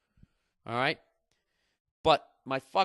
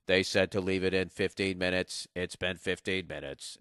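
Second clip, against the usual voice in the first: robotic; 6 semitones lower than usual. Acted. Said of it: "I'm a little more robotic".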